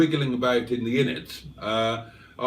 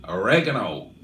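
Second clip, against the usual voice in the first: oregano said the American way, lower; American pronunciation; 5 semitones lower than usual. Retold or imitated. Host shows viewers how American friends say oregano, American accent. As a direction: imitate an American saying oregano, stress on the second syllable. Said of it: "as our American friends would say".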